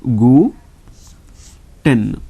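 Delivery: gu as "goo", pause, then ten; teacher splitting gu ten, long "oo"; syllable by syllable. Shown as a demonstration. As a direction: sound out gu ... ten separately. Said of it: "U is U sound".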